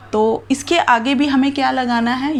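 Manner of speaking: normal talking voice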